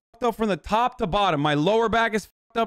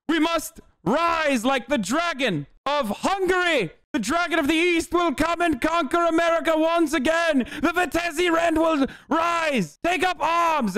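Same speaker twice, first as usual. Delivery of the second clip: higher, shouted pompous war cry; rally the troops like a ranting strongman; Sebastian Gorka; 7 semitones higher than usual. Retold or imitated. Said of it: "Sebastian Gorka is literally like".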